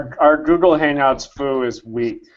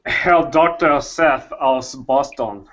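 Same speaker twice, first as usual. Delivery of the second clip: put-on German-ish accent. Introduces himself as a vague German doctor. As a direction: say it like a hammed-up German professor. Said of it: "I don't know what accent, accent that was".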